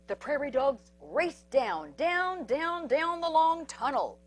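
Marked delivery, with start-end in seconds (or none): none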